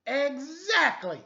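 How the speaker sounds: high, loud, slow exclamation; exclaimed. Acted. Exclamation mark butting in, exclaiming loudly. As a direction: bellow it out. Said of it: "exclaimed exclamation".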